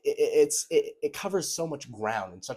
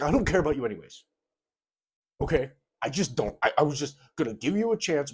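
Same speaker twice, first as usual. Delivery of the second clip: high, fast sneering guy voice; mock dismissive voice quoting the rude guy; 4 semitones higher, 5 dB louder, faster than usual. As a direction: toss it off like a sulky guy brushing her aside. Mocking the guy's dismissive message.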